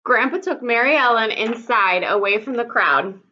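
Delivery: ordinary delivery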